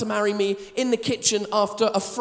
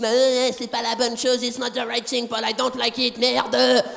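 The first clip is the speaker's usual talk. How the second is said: mock French wife grumbling about the gift. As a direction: fake a grumpy French accent, half in French. Wife voiced, French complaining.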